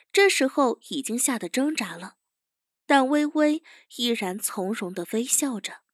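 plain reading voice

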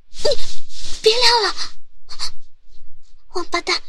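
loud high scolding cry; angry high yell. Acted. Angry at skirt lifting.

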